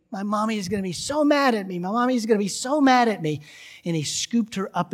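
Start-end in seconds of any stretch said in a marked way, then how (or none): 0.1-3.4 put-on high, fast, upset child; hammed-up wail in words, pitched up and rushed; mock tearful screaming. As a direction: imitate a panicking little girl. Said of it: "She burst into tears, screaming that".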